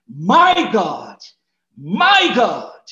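MY swelling loud in "My God", said twice; emphatic repetition. Acted. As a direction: call out "My God" twice, leaning hard on MY. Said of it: "for emphasis, he says it twice".

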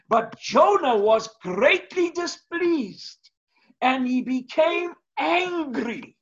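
high, slow stress on Jonah; emphatic scripture reading. Shown as a demonstration. Preacher reading Jonah's anger to the congregation with weight. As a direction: dwell on Jonah with heavy weight. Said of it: "text starts today".

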